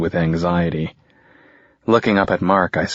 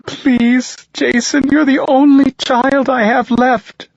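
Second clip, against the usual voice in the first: high, louder desperate plea; mother pleading with her only child, high and louder; 6 dB louder, slower than usual. A narrator's reading. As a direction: beg him as a mother in tears. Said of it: "desperation in her voice when she pleads".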